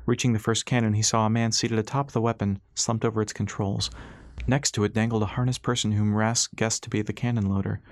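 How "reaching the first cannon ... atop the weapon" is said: ordinary delivery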